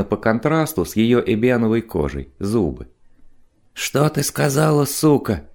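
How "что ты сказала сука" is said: higher, understated menace; threatening. A narrator's reading. Biker challenges the woman who interfered, mildly threatening. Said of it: "угрожающе произнёс Тухлый".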